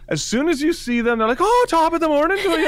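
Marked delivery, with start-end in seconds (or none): 1.4-2.7 high, hammed-up Irish brogue; mock Irish accent. Teasing the office accent-doer, exaggerated Irish accent. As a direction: put on a corny Irish accent. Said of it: "have to do an accent".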